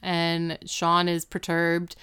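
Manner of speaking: normal talking voice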